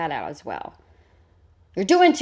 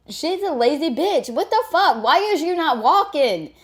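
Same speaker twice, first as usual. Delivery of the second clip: higher, exasperated rant; mock outrage; 7 semitones higher, 3 dB louder than usual. Real, not acted. Ranting at the woman in the video, mock outrage. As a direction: throw up your hands at her.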